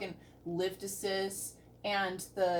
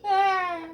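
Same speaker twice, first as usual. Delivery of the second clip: loud, high wail; anxious scream; 10 semitones higher, 10 dB louder than usual. Real, not acted. Viewer told the ride is never safe, high wail. Screams during the rescue clip.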